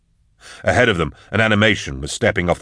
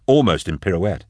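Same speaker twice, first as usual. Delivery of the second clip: ordinary delivery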